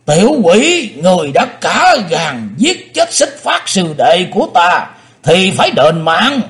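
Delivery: high, loud ghostly demon voice; demonic double voice. A narrator's reading. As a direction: threaten like a vengeful ghost. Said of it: "cất giọng quỷ hồn".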